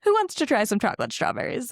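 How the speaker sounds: changing the subject with mock cheer; Effie voice. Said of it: "And she's like".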